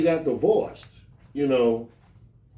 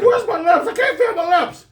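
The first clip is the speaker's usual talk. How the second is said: high, loud, laughing; hammed-up dazed after a slap; mock stunned kid; 13 semitones higher, 6 dB louder than usual. Slapped boy crying out to teller, high and loud.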